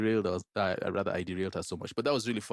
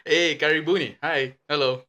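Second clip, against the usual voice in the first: excited greeting; 8 semitones higher, 11 dB louder than usual. Real, not acted. Hammy show greeting after laughing.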